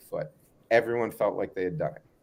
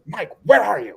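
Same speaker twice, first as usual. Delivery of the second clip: higher, louder call into the woods; 9 semitones higher, 7 dB louder than usual. Retold or imitated. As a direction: shout across to find him. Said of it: "And he goes".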